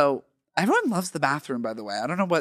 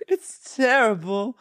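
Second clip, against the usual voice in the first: slow, higher diva wail; 6 semitones higher, slower than usual. Acted. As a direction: draw it out like a mock diva. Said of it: "we're gonna retire Miss [name]".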